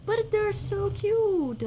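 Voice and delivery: high, slow cooing over cute things. Real, not acted. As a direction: coo it like to a puppy.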